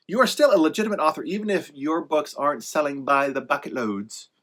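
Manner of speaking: normal talking voice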